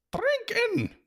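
very high, loud chorus cry; tiny warriors bellowing; Feegle crowd shout. A narrator's reading. Crowd answer.

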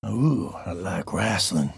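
low, slow, soft cowboy purr; lightly voiced cowboy. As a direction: lean in like a sly cowpoke. Cowboy voice game.

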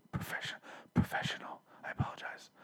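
whispered careful correction; sheepish whisper. Real, not acted. Podcaster apologizing to listeners for popping p's, sheepish whisper.

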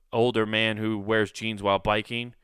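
ordinary talk

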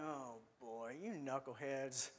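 low, soft, weary eye-roll groan; exasperated sigh. Retold or imitated. Jesus rolling his eyes at his disciples, low and weary. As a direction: sigh at the knuckleheads. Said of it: "Jesus is like".

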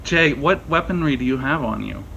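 usual speaking voice

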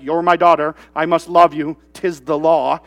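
low, stiffly recited sense of duty; dutiful obligation love. Acted. Father to daughter out of obligation, dutiful. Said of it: "It's not obligation love".

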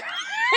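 high squealing burst of laughter; shrieking laugh. Real, not acted. Laughing at her own silly reply, high shriek.

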